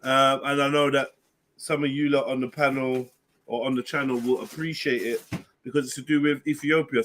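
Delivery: normal talking voice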